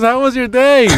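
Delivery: high-pitched holler from afar; imitated mom calling out. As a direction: call a friendly question across a big yard. Mom calls from hundred yards.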